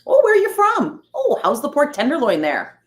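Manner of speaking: high, loud put-on regional accent; mock folks from Indiana in an exaggerated accent. Imitating Indiana people asking about pork tenderloin, put-on accent. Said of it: "I don't know why I'm using that accent".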